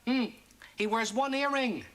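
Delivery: usual speaking voice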